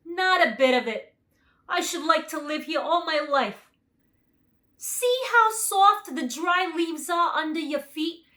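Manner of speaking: high, loud lion voice; the lion, full of joy; lion's voice. A narrator's reading. Lion continues speaking.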